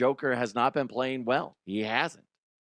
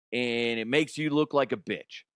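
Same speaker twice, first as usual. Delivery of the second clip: ordinary talk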